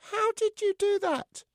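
very high puppet astonishment; amazed dummy. Acted. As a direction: ask in squeaky amazement. Amazed dummy asks Joe.

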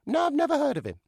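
high, loud puppet reply; chirpy dummy. Acted. Dummy answers Joe.

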